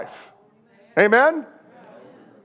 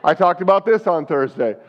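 ordinary delivery

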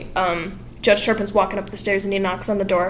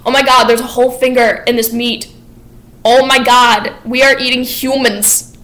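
louder, rising alarm at a gruesome find; mock horrified discovery; 3 semitones higher, 8 dB louder than usual. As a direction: play it as a shocked gasp of realization. [name] discovers finger in the meat.

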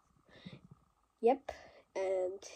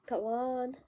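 ordinary talk, nothing marked

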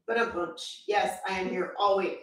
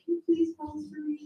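imitating husband asking nicely; 6 semitones higher than usual. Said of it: "He just asked really nicely".

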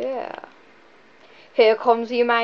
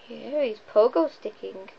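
ordinary delivery

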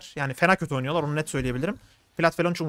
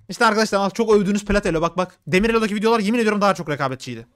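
ordinary delivery

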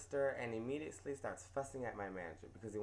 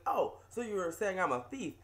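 indignant; 5 semitones higher, 6 dB louder than usual. Retold or imitated. Customer quoted, indignant.

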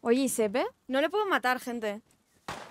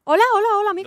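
llamando fuerte y agudo, muy animada; saludo exagerado; 7 semitones higher, 5 dB louder, much faster than usual. Real, not acted. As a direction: saluda al desconocido a voces, amistosa. Greets stranger in game. (al desconocido del juego, saludo fuerte y agudo)

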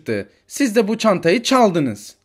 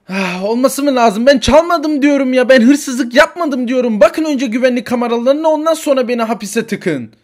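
higher, louder indignant pleading; loud protest; 8 semitones higher, 6 dB louder than usual. Acted. Woman at the cell door protesting to police, indignant. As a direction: demand they check the cameras.

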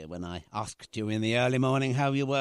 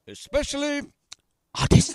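much higher and much louder, booming; mock-grand shout; 12 semitones higher, 9 dB louder than usual. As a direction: top her echo with a big hammy shout. Playful echoing game with daughter.

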